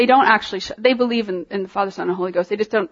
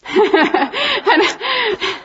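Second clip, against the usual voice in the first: high, breaking laugh; real laughter; 10 semitones higher than usual. Real, not acted. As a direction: burst out laughing, pitched high. Breaks into laughter after her remark.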